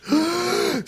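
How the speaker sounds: high sharp gasp; a sudden gasp of overwhelm; retold gasp. Retelling breakdown before God, high sharp gasp.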